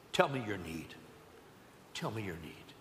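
low, soft voice of Jesus; gentle invitation. Acted. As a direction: ask softly, sinking almost away. Jesus gently voiced, asking their need.

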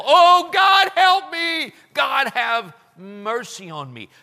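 loud, high, desperate plea to God; cry for help. Acted. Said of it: "honest, sincere cry for help".

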